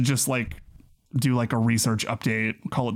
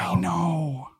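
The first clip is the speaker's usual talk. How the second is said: whisper; 3 semitones higher than usual. Real, not acted. Whispering during the phone call.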